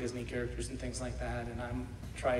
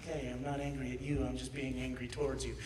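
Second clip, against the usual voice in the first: soft mock calm; 7 dB quieter than usual. Shown as a demonstration.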